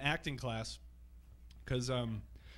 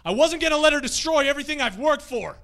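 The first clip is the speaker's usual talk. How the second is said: much higher and louder, hammed-up outburst; overacted climactic line; 10 semitones higher, 8 dB louder than usual. Acting student shouting his big line at Sipowicz. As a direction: blow the line out like a bad actor. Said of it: "I was like".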